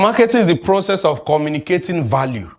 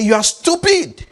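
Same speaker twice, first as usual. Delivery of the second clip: mock insult, pitched much higher; rude customer; 12 semitones higher than usual. Imitating a rude customer insulting the seller, high and mocking. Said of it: "Customer comes and say".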